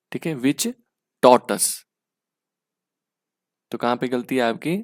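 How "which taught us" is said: taught punched hard and loud; drilling the word taught for students. Shown as a demonstration. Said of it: "repeating the correct answer".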